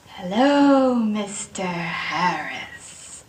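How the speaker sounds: slow drawn-out greeting. Acted. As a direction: stretch each word, fading out. Rehearsing greetings, slow drawn take.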